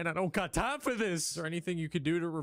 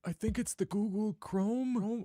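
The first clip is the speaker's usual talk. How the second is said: put-on old man voice; 6 semitones higher than usual. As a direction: fumble the word like an old man. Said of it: "my fucking old man voice".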